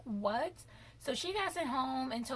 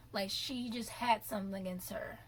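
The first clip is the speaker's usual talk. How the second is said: normal talking voice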